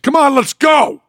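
high, hollered urging; impatient rallying yell; egging him on. Retold or imitated. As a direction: push the doctor onward, pitch shooting up. Retold patient egging doctor on.

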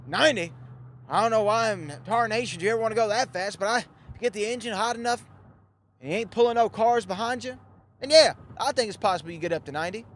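a hint of a folksy train conductor. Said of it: "I love this guy, by the way, this train conductor".

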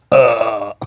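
long, drawn-out "ugh"; comic dying groan, held out; dead lobster impression. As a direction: mimic a lobster lying dead. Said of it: "Blue's like this".

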